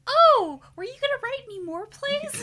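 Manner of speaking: very high, loud put-on voice; mock taunt. Attacker taunting zombie Shakespeare, high mock taunt. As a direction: taunt in a squeaky-high play voice.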